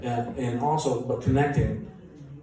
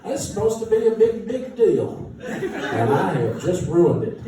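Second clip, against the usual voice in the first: high, strained panicky inner voice, slowed; 11 semitones higher, slower than usual. Retold or imitated. Musician meeting [name], panicking inside. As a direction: squeak out the inner panic, half laughing. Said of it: "Inside I'm like".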